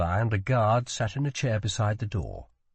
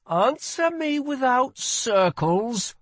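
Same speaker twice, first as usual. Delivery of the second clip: very high, slow, insistent order; demanding; 14 semitones higher, 4 dB louder, slower than usual. A narrator's reading. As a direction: spell out the demand, pitched high. Prince demands a straight answer.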